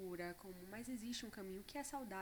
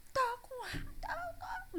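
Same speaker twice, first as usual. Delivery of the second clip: much higher, louder mock sobbing whine; crying child; 5 dB louder, slower than usual. Mother imitating her crying daughter admitting her anger. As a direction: wail it like an angry toddler. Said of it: "e chorando".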